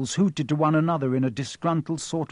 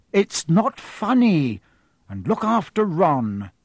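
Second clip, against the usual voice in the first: cross mother; 4 semitones higher, 6 dB louder, slower than usual. A narrator's reading. Mother scolding.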